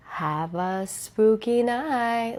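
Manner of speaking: soft, very slow spooky voice; spooky sign-off. Pretend. Host signing off to followers, playfully spooky. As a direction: draw each word out softly and eerily.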